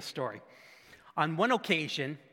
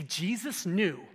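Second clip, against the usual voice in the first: usual speaking voice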